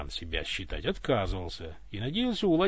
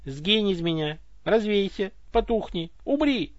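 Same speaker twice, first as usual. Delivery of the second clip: angry outburst; 8 semitones higher, 4 dB louder, slower than usual. Acted. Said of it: "Обрушился он на Ивана".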